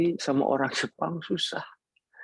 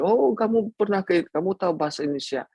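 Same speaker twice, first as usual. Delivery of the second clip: surprised question; 8 semitones higher, faster than usual. Retold or imitated. Retells Japanese man's surprised reply.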